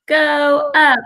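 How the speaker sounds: put-on broad singsong kids theatre. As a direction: call him upward like a kids' show host. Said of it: "Tell him to go up".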